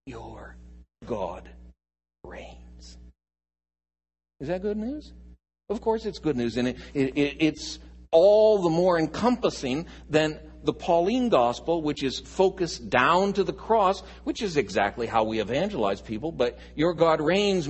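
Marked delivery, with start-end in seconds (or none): 0.1-3.0 whispered proclamation. Shown as a demonstration. Preacher whispering the good news to the congregation. 16.7-17.7 louder, declared with force; loud proclamation. Shown as a demonstration. Preacher proclaiming boldly to the congregation.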